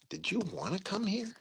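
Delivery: hushed, high-pitched mimicked disbelief; puzzled question. Retelling the general's baffling question to an officer, incredulous. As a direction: repeat his baffled question, small and high. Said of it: "I was flabbergasted by that".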